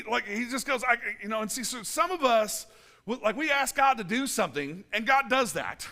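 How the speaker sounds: ordinary delivery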